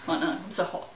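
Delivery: normal talking voice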